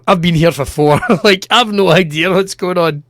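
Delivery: ordinary delivery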